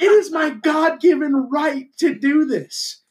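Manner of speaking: high, slow, righteous proclamation; mock patriotic fervour. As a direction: declare it like an overwrought patriot. Acting out a proud American hunter.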